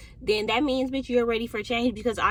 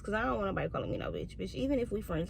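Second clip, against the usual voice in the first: normal talking voice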